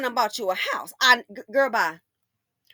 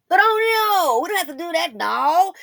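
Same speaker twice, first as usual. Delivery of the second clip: high, loud sarcastic whine; mock whine; 4 semitones higher, 6 dB louder than usual. Mocking women who refuse a man's gifts, high sarcastic whine.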